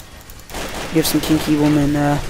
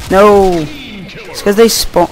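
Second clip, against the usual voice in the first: shouting; 5 semitones higher, 4 dB louder, much slower than usual. Real, not acted. Killed by own teammate.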